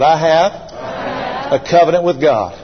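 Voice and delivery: slow, rising declaration of faith; preaching creed, slow and swelling; congregation recital. Shown as a demonstration. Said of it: "Everybody say this out loud".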